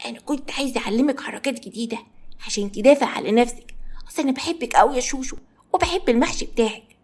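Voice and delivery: ordinary delivery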